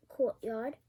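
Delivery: usual speaking voice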